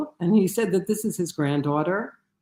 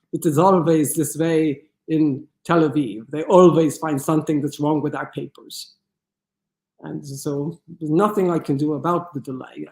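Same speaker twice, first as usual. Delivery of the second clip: put-on German accent. German pilot blaming Tel Aviv for the delay, in a German accent. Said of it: "in my best German accent".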